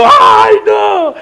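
put-on high, loud frightened cry of a boy; childish yelp. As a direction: shriek it like a scared child. Child scared of nun's scolding.

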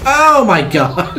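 slow, high, laughing oh my god; laughing exclamation. Real, not acted. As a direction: laugh through the shock. Laughing after sudden blast.

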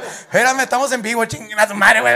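loud, high, panicked yell; panicked shout. Pretend. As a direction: shout while running. Imita a sí mismo huyendo. (grito de pánico en vivo)

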